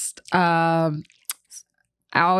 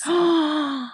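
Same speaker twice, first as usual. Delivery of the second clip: sharp, high intake of breath; big audible gasp; amazed gasp; 10 semitones higher than usual. Real, not acted. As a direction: gasp at the news. Reacting to episode six news.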